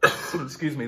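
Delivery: ordinary talk, nothing marked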